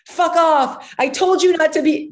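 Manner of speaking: girl playing mom telling him off; mock angry little girl. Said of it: "She goes".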